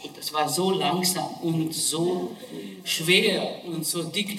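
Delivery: ordinary delivery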